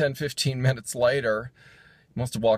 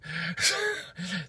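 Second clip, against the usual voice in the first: short laugh; 9 semitones higher, 5 dB quieter than usual. Real, not acted. Laughs after the punchline.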